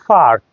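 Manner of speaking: ফার্ক with a puffed opening P; slow ফার্ক, P like ফ; aspirated P. Shown as a demonstration. Teacher modelling the right take, aspirated ফার্ক. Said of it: "not পার্ক".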